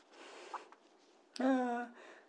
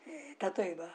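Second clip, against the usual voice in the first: ordinary talk, nothing marked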